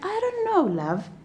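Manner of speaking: lightly voiced wife, affectionate; sweet Eve. Eve answers her husband, softly and lightly voiced.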